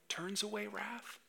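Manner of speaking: very soft, high-pitched gentle question; hushed, tender parent teaching a child; getting soft. Shown as a demonstration. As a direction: drop right down to soften the answer. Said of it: "actually get soft like that".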